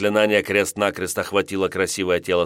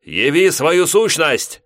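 slow, high, full-voiced spell cry; shouted incantation; 8 semitones higher, 7 dB louder, slower than usual. A narrator's reading. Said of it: "выкрикнул во весь голос".

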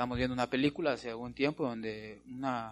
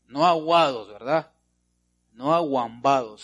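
emphatic loud; 11 dB louder than usual. Acted. Preacher rejecting weak hearts.

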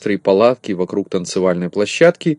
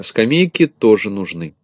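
usual speaking voice

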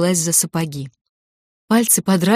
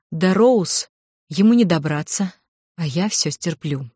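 plain reading voice

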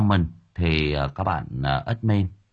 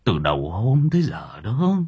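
put-on softer, lower dying old man's rasp; dying grandfather whispering his last wish; feeble whisper; 5 semitones lower, 3 dB quieter than usual. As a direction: barely get the words out. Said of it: "miệng thều thào".

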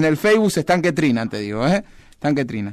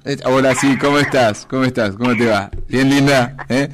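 seductive voice; 6 semitones lower than usual. Pretend. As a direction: purr it like a smooth flirt. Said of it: "Eh, pone voz seductora, [name]".

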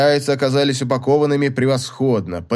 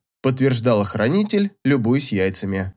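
usual speaking voice

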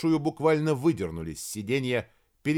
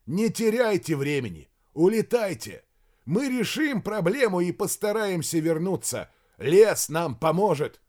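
raised, higher insistent voice; urgent shouting; 6 semitones higher, 4 dB louder than usual. A narrator's reading. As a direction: urge him away across a distance. Major urges colonel to leave. (настойчиво кричать)

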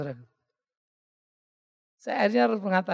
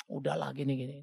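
calming; 5 semitones lower, 10 dB quieter than usual. Retold or imitated. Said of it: "Istri saya nenangin".

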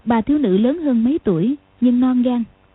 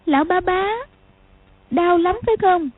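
put-on gentle child call; 7 semitones higher than usual. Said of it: "gọi".

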